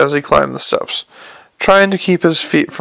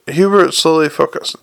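ordinary delivery, nothing marked

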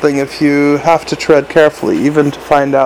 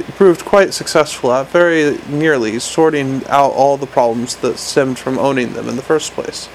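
usual speaking voice

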